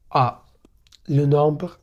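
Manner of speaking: ordinary talk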